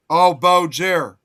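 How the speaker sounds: normal talking voice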